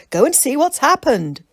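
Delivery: loud high shout. A narrator's reading. Mick calling back while holding Tessa, shouting. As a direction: call it out across the field. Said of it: "shouted Mick".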